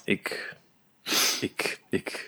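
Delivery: breathless, barely voiced stammer; speechless whisper. Real, not acted. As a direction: whisper it, lost for words. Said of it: "Ja, sprakeloos".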